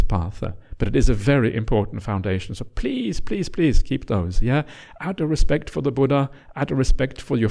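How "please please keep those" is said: normal talking voice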